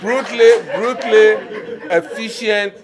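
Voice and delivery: brutally efficient, shouted high and loud with a laugh; emphatic loud correction. Real, not acted. Friend corrects the word, he booms it back loudly. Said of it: "efficient is the word".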